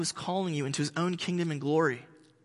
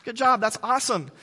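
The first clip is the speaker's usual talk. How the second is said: high, bright praise over a small win; mock-gushing parent cheering a toddler; encouraging parent praise; 6 semitones higher than usual. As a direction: gush like a proud parent. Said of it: "you move on to encouragement".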